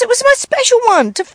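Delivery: put-on high, loud wailing kid; hammed-up daughter in a panic; upset daughter. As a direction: wail it like a distressed child. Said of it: "She goes".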